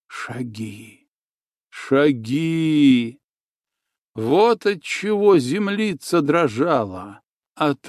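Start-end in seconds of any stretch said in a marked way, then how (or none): 0.1-1.1 almost inaudible, much softer; hushed footsteps. A narrator's reading. Echoing repeated footsteps word. 1.7-3.2 firm repeat. A narrator's reading. Echoing repeated footsteps word.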